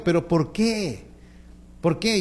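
drawn-out, questioning complaint; mildly acted exasperation; exasperated child. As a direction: protest like a wronged kid asking why. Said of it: "el niño se exaspera".

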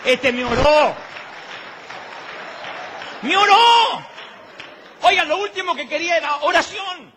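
mock outrage. Comic rant about wanting money.

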